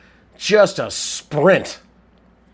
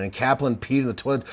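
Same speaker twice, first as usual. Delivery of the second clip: ordinary talk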